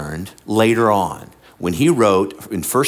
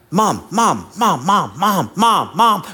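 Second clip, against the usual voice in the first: higher-pitched, repeated mock nagging; pestering child; 8 semitones higher, slower than usual. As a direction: imitate a child insistently calling for mom. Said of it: "kinda like your child".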